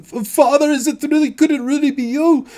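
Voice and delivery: a daughter overwhelmed to find her father alive; high, loud, breaking young woman's voice; daughter's tearful cry. Acted. Daughter embraces long-lost father.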